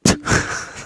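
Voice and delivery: a short breath of a laugh; breathy laugh. Real, not acted. Host laughing at his own dark praise, breathy.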